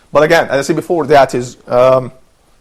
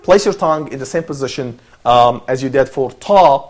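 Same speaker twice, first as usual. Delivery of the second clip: usual speaking voice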